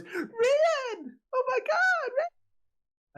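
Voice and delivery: put-on very high, squealed child's cry; small child voice. Small child spots Ryan and cries out, squealed child voice. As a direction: squeal it out like an excited little kid. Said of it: "a small child voice goes".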